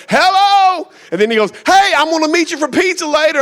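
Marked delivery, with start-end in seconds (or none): 0.1-0.9 high, loud mock phone greeting; yelling. Preacher imitating older man interrupting his sermon, yelled. 1.6-3.4 high-pitched, oblivious phone holler; yelling on phone. Retold or imitated. Preacher imitating older man making pizza plans, yelled.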